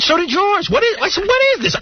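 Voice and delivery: high, indignant outburst; exasperated retort, pitched high. Retold or imitated. Retold exasperated argument with wife.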